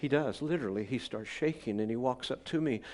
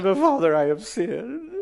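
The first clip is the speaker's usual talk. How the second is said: put-on high, loud, blubbering drunk plea; drunk pleading; 7 semitones higher, 10 dB louder than usual. Drunk guest pleads with pastor, loud and high. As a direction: beg like a weepy drunk. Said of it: "He said".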